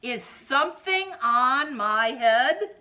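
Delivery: loud, slow worried cry; elephant yelling. Acted. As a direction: call out to Piggy in alarm. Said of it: "so the elephant yelled".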